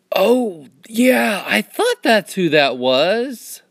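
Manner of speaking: high-pitched, drawn-out realization; dawning recognition. Acted. Acted moment of recognizing a voice.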